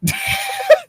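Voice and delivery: shrill laugh; high squealing giggle. Real, not acted. Reacting to snark.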